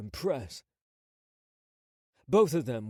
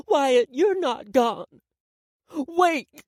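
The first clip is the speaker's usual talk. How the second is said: slow, loud, high panicked cry; 11 semitones higher, 10 dB louder, much slower than usual. Acted. Mother panicking over son.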